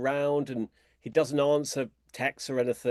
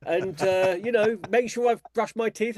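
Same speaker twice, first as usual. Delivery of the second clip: high, put-on needy voice, stretched out in the middle; mock him asking for a servant, laughing through it; whiny imitation of Lawrence; 8 semitones higher, 3 dB louder than usual. Author mocking Lawrence wanting a helper, high whiny voice. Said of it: "he'll say things like".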